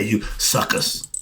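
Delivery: soft breathy jab, slow and unvoiced; whispered aside. Real, not acted. Street preacher whispers an insult at the crowd. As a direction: slip the insult in under your breath.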